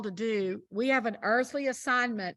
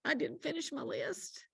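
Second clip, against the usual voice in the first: tearful woman; 5 semitones higher, 7 dB quieter than usual. Retold or imitated. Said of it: "And she said".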